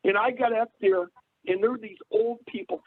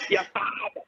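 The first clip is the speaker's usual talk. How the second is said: stepmother's Boston "Your father"; mock Boston accent. Son mimicking the stepmother who drove him insane, Boston accent. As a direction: imitate her Boston accent, voice dropping out. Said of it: "She drove me insane".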